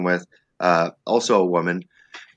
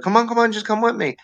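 high teasing coax; wife coaxing; 12 semitones higher than usual. Retold or imitated. Wife luring him onto the Ferris wheel, high coaxing voice. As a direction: coax him toward the ride. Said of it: "she'll be like".

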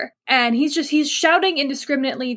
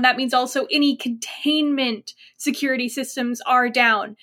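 ordinary talk

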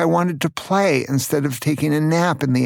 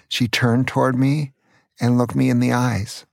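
plain reading voice

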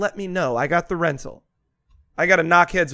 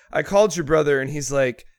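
ordinary talk, nothing marked

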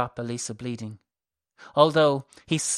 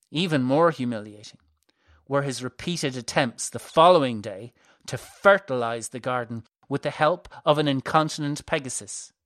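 usual speaking voice

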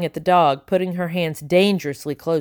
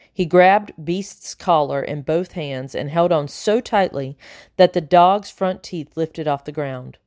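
ordinary delivery

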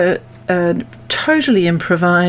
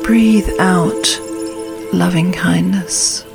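calm grounding exercise. Acted. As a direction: guide them gently into stillness. Leads a meditation.

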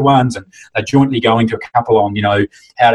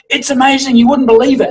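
gushing; 13 semitones higher than usual. Retold or imitated. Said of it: "and of course they go".

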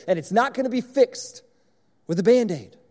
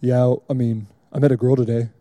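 lower, deep bored monotone; monotone Kason; 7 semitones lower than usual. Retold or imitated. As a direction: say the good news like it means nothing. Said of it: "talking about it in a monotone".

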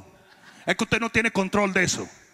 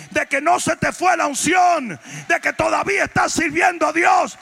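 raised, insistent sermon voice; impassioned preaching; 5 semitones higher than usual. Real, not acted. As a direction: exhort the congregation, building phrase by phrase. Preaches at full force to congregation.

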